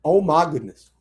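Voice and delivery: normal talking voice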